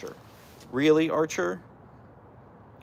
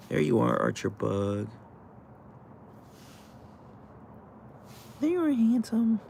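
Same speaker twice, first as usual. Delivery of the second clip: soft high pet voice; 6 semitones higher, 7 dB quieter than usual. Real, not acted. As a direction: coo at the dog. Baby talk to her dog.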